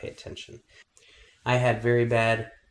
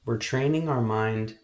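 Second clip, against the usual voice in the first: guided meditation. Acted. Meditation teacher helping kids focus on their breath, calm guiding voice. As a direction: guide them calmly through each breath, unhurried.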